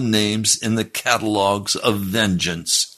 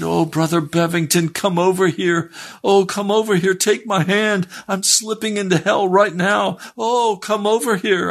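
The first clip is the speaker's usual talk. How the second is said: desperate begging; 12 semitones higher than usual. Retold or imitated. Sinner slipping into hell calls preacher, acted desperate plea. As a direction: imitate a high, urgent wail.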